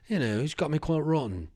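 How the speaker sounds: a touch of put-on British accent; mock British accent. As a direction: repeat it in a jokey British accent. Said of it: "I don't think British people talk like that".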